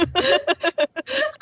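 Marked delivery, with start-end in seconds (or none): 0.0-0.1 crying laughing. Real, not acted. Laughing so hard she cries.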